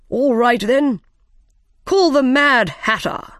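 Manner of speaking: summoning king. A narrator's reading. King calling a witness into court, summoning. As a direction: call out the witness for all to hear.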